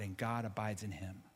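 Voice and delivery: hushed pastoral close over music; soft closing appeal. Acted. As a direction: bring it down gently for the altar call.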